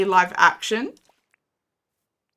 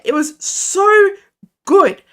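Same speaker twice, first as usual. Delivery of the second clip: slow, loud drawn-out praise; gushing; 8 semitones higher, 6 dB louder, slower than usual. Real, not acted. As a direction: stretch it out with delight. Enthusing about show.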